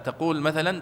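ordinary talk, nothing marked

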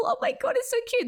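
squealing with real delight; gushing. Real, not acted. Gushing about the kids' joy.

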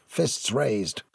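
ordinary delivery

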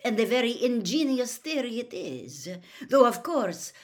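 loud, cold sarcasm; mock him with icy politeness; pointed, scornful telling, falling away at the end; cold mockery. A narrator's reading. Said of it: "said Karkaroff coldly".

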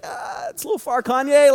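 high, wincing mock protest; comic high-pitched discomfort; awkward objection. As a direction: squeak out a reluctant objection. Acted awkward reaction to celebrity's boast.

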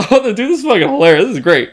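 imitating his own hysterical reaction; cracking up. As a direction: gush through laughter.

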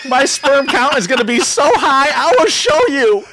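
mock defiant shout. Son yelling at his stoner dad, laughing mock defiance. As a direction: yell it at your dad through laughter. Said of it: "it was like".

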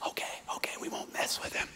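hushed unvoiced whisper. Soldiers whispering to each other by the sickbed, hushed. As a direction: tiptoe away, whispering not to wake him. Troops backing off sick man.

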